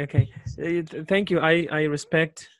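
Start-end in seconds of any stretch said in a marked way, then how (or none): none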